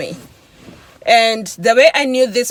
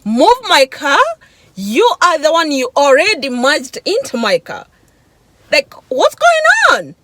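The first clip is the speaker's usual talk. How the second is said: higher, louder mock outrage; retold indignant retort; 6 semitones higher, 4 dB louder than usual. Driver answering the man who cut into her lane, indignant retold retort. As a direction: throw it back in indignation, pitch climbing. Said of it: "I'm like".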